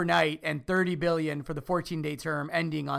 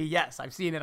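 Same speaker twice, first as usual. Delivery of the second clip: ordinary talk, nothing marked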